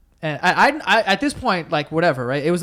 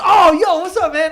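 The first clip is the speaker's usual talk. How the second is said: loud, high delighted greeting; excited recognition; 12 semitones higher, 10 dB louder than usual. Retold or imitated. Stranger recognizing Joe Santagato, loud high excited greeting. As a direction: burst out like you spotted a celebrity.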